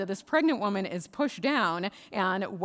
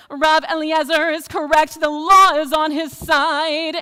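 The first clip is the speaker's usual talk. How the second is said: booming, high, drawn-out proclamation; voice of God; 8 semitones higher, 15 dB louder, slower than usual. Acted. Voice from heaven ruling for the rabbi, booming proclamation. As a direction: thunder it out slowly from the heavens. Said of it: "this voice from God comes down".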